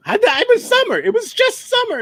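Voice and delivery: high squeaky voice; helium voice. Pretend. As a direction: talk in a tiny chipmunk voice. Voice pitched up after "travel.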